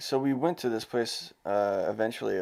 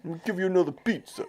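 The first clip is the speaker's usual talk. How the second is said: mock New York accent; 8 semitones higher, faster than usual. Co-host as the food-stall guy offering more food, mock New York accent. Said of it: "turned into a New York accent".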